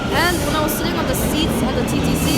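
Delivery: high, loud and worked up; really freaking out, voice climbing; agitated. Real, not acted. Ranting to the camera about disease, loud and high.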